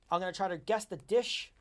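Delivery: soft, hushed announcer pitch, like a weird yogurt commercial; ad read voice. Acted. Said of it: "that has actually sounded like a weird yogurt ad".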